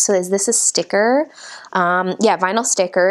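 ordinary talk

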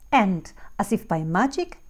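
ordinary delivery, nothing marked

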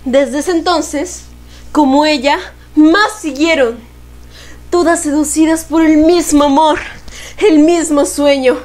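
measured, solemn recital; poem recitation. Acted.

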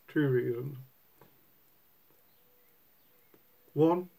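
talking around pipe stem; speaking with clenched teeth; pipe in mouth. Real, not acted. Seen 5: Pipe stem held in mouth from +0.5; in hand at +0.2.

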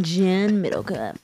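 soft, very slow "John Middlecoff"; copied name pronunciation. Shown as a demonstration. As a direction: copy "John Middlecoff" slowly, softening the last syllable. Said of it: "Say it like this".

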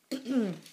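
small throat-clearing; polite cough. A narrator's reading. Teacher hesitates before doubting Jonathan, coughing politely. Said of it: "His teacher coughed politely".

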